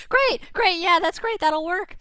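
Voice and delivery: high, squeaky little fish chatter; squeaky worried anchovies; tiny anchovy voice. Acted. Anchovies agree.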